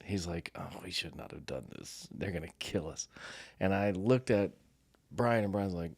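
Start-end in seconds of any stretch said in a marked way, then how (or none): 0.5-3.1 hushed, low mock panic; panicked worry. Mike fretting to the group about getting caught, hushed mock panic. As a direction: fret under your breath, dreading the fallout. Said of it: "Mike's losing his mind".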